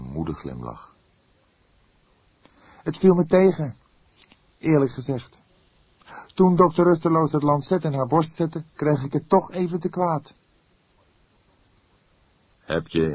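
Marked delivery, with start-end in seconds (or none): 2.8-10.3 put-on higher young man's voice, a little shaken; the junior partner admitting the autopsy got to him; young detective voice. Young colleague reporting to the older inspector, higher and shaken.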